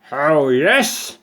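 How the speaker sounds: loud, high goat taunt; growled challenge. A narrator's reading. Goat daring the ant to prove it, loud taunting growl. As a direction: dare him with a growl. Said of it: "growled Goat".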